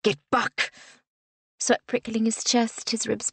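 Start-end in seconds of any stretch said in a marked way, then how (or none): 0.0-0.7 raised-voice warning, moderately loud; a contained, narrated shout. A narrator's reading. Man lashing out at the French soldier, shouting.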